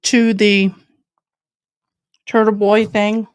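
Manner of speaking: talking while eating; words round a bite of food; chewing noisily. Real, not acted. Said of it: "I'm sorry I'm eating".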